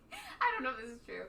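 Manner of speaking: high giggle spilling into the words; tickled, laughing disclaimer; giggling. Real, not acted. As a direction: try to talk through real giggles. Giggling while starting a dubious fact.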